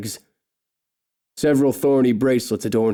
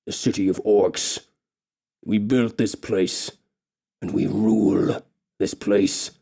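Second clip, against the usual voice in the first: menacing orc king; 2 semitones lower, slower than usual. Acted. Orc king speaks to captive.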